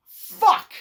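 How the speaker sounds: loud, high exasperated yell; a sudden loud swear of annoyance; mock outburst. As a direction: blurt it out like a groan of despair. Reacting to Monopoly being chosen.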